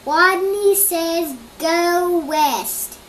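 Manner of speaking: loud, slow high call. Acted. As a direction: call it across the playground. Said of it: "Rodney called".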